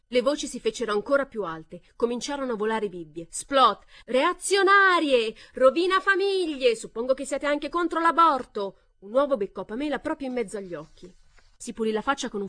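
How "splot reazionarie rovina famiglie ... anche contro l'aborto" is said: very high, loud, slow insults; furious screaming. A narrator's reading. Brawling women screaming insults, loud and slow. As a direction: hurl the insults.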